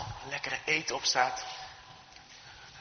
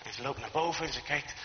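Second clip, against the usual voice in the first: usual speaking voice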